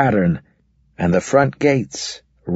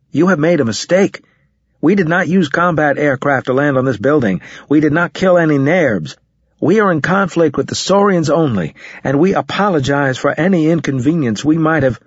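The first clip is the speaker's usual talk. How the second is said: heated; 7 semitones higher than usual. A narrator's reading. Narrator argues with the alien.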